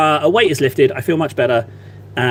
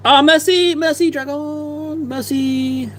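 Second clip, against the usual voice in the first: high, hammed-up French thanks; playful French thank-you, pitched high; mock French; 10 semitones higher, slower than usual. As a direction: gush in a silly French accent.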